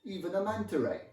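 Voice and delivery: loud, high, exuberant Italian; sing-song Italian accent. Acted. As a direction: enthuse loudly in lilting Italian English. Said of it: "she loved that sing-song accent".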